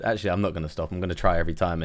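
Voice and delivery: normal talking voice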